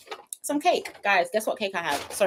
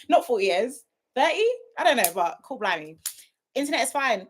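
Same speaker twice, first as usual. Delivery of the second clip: ordinary talk, nothing marked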